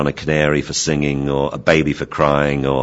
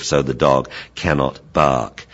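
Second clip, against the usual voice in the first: usual speaking voice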